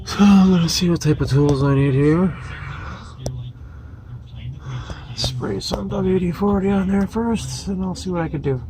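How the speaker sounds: strained talk while lying on back; lying under a car. Real, not acted. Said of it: "Now I'm under the car".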